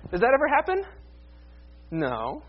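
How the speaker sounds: normal talking voice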